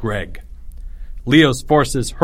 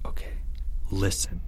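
very soft, low whisper; 4 semitones lower, 11 dB quieter than usual. A narrator's reading. Leo briefing his soldiers in hiding, whispering. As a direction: whisper for their attention. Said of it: "Leo whispered".

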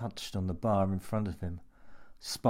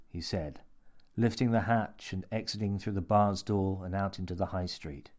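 ordinary delivery, nothing marked

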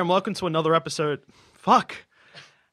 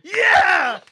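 triumphant cheer; 10 semitones higher, slower than usual. Real, not acted. Host celebrating a finished intro, dedicating it to Mom, triumphant. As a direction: cheer like you just won.

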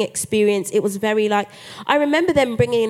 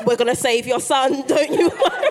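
laughing reassurance; 4 semitones higher, 4 dB louder than usual. Retold or imitated. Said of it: "she was like".

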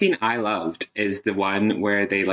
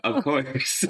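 laughing; 9 semitones higher, much slower than usual. Real, not acted. Laughing reply to grim tale.

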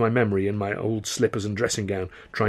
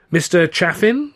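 slow, higher-pitched polite query; inquiring; 7 semitones higher, 5 dB louder, slower than usual. A narrator's reading. Lawyer greeting his three visitors, inquiring. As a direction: ask it slowly, lifting at the end. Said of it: "he inquired".

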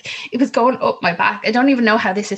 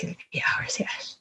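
mock doctor muttering, whispered; whispered aside; 2 semitones lower, 11 dB quieter than usual. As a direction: hush it like staff talking over you. Doctors' remark over her head.

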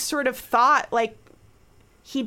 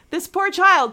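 pitying, louder; 7 semitones higher, 7 dB louder than usual. Real, not acted.

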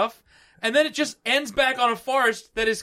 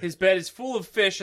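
ordinary talk

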